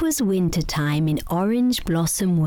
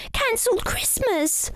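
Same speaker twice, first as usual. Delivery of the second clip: put-on high, anxious child voice; worried little rabbit. A narrator's reading. Young rabbit telling wood elf, high and anxious. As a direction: say it fearing the worst. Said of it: "said Barnaby".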